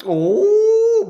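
long, high, loud "oooh"; a drawn-out singsong tease; teasing ooh. Acted. Teasing oooh after nosy question.

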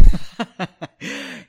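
loud high burst of laughter; real loud cackle after the rant. Real, not acted. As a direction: laugh out at your own joke. Laughs at his own rant.

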